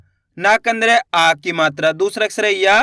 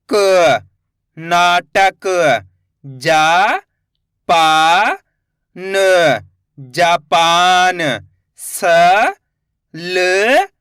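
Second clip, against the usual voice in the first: slow syllable drill; 3 semitones lower, much slower than usual. Shown as a demonstration. Teacher sounding out letters for children, slow and clear.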